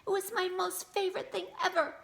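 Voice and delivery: high, loud outraged little sister; angry yell. A narrator's reading. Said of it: "She yelled at him".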